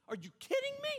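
shrill, very high disbelief; incredulous squeal. Acted. Preacher mocking a mourner's disbelief, squealing high. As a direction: go way up in stunned disbelief.

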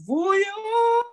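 put-on "Booyah" held long and high in a Liberian accent; long Liberian call. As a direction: stretch the name out like a parent calling. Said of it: "They got the long one".

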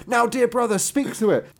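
high, loud cheerful invitation; excited. A narrator's reading. Same line reread differently earlier.

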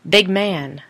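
mild mock scream. Ghost screaming at dad, mild mock yell. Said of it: "Once it screamed".

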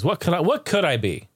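normal talking voice

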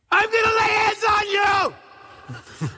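full-volume high-pitched holler, drawn out; shouting. Retold or imitated. Preacher to the next person in line, shouting. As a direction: shout it out slow and high over a roaring crowd. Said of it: "literally, I am shouting".

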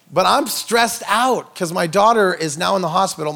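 usual speaking voice

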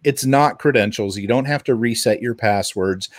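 ordinary talk, nothing marked

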